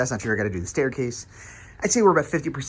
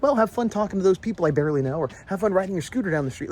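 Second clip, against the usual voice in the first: higher-pitched mock cheer; sarcastic send-off; 5 semitones higher, 5 dB louder than usual. Parent pretending to let kid wander off, sarcastic mock cheer. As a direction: feign breezy permission, exasperated.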